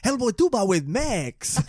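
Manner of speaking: drawn-out joke pitch in a put-on accent; Guillermo del Toro. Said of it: "Guillermo del Toro coming into a pitch meeting".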